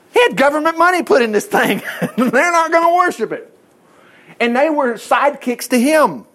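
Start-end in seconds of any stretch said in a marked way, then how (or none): 0.1-3.5 laughing. Real, not acted. Preacher laughs at angry king.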